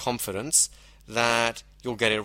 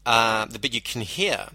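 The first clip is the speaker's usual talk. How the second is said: usual speaking voice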